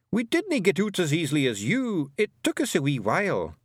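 high, cocky Scots brogue; a proud wee Feegle warrior; broad Scots Feegle; Scottish pixie voice. A narrator's reading. Rob Anybody's Feegle voice.

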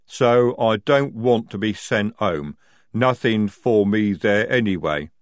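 loud, lumbering troll voice; slow-witted troll. A narrator's reading. Jade the troll telling of a fight, lumbering. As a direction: plod heavily through the confession.

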